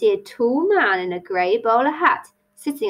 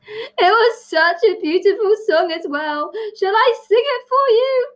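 hammed-up weepy Hatter, loud and high; noisy tears; 12 semitones higher, 4 dB louder than usual. A narrator's reading. Hatter offers his song to Tilly, theatrically tearful. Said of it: "burst into noisy tears".

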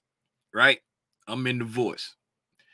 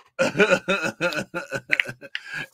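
long, easing chuckle; amused laugh. Real, not acted. Streamer still laughing at own distraction joke, long chuckle. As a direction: let the laughter roll on and settle.

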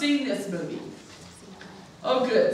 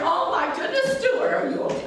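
frantic mom; 7 semitones higher than usual. Acted. Mother finds son.

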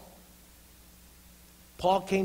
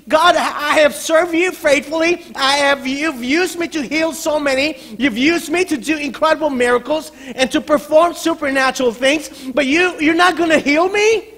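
loud, high, incredulous appeal; disappointed protest; 9 semitones higher, 11 dB louder than usual. Acted. Paul protesting that God will not heal him, disappointed outcry. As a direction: plead your case to God at full voice. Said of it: "this, the disappointment perhaps with Paul".

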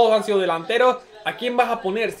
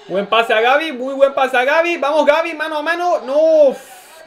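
excited cheering; 6 semitones higher, 5 dB louder than usual. Real, not acted. Cheering a near chance live.

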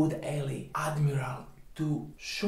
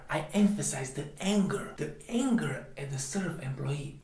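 usual speaking voice

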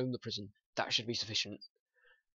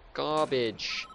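ordinary talk, nothing marked

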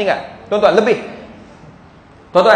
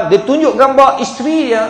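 normal talking voice

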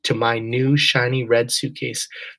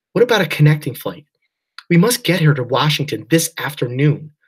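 louder, faster, pressing demand; urgent father; 4 semitones higher, 6 dB louder than usual. A narrator's reading. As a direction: push hard for another flight.

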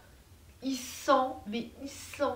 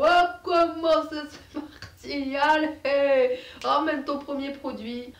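fairground crier; 2 semitones higher, 4 dB louder than usual. Acted. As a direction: holler like a hawker at a pig fair. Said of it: "l'impression d'être à la foire aux cochons".

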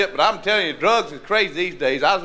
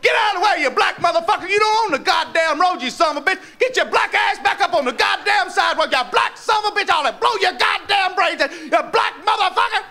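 much higher, screaming abuse; furious yelling; 11 semitones higher than usual. Acted. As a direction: scream it out the car window. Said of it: "we getting ready to go".

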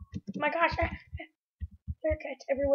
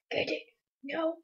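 ordinary talk, nothing marked

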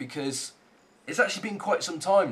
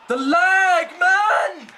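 much higher and louder yell of rage; furious shout; 12 semitones higher, 8 dB louder, much slower than usual. Real, not acted. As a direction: bellow it at the game. Raging after missed chance.

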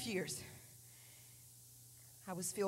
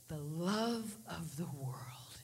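slow low whisper as the Lord; hushed slow divine whisper; voice of God; 7 semitones lower, 9 dB quieter, much slower than usual. Retold or imitated. God answering the praying girl, slow low whisper. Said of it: "And he said".

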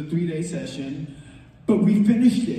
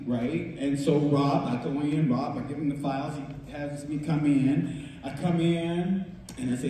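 normal talking voice

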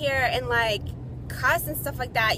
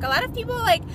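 normal talking voice

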